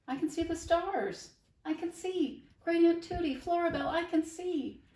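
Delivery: high, loud, thrilled shouts of wonder; a blind boy who suddenly sees; voiced boy crying out that he can see; overjoyed cry. Blind boy sees stars again.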